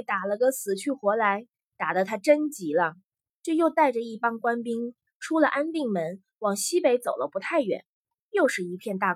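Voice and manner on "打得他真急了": usual speaking voice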